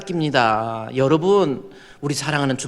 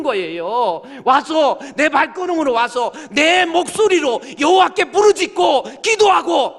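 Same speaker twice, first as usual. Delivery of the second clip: fiery preaching; 8 semitones higher than usual. Acted. Pastor urging his flock to cry out in prayer, preaching at full force. Pastor exhorting congregation to pray.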